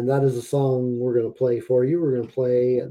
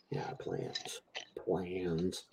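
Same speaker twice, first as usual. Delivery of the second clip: whispered; 6 semitones lower, 19 dB quieter, much slower than usual. Real, not acted. Mocking the idea of plans.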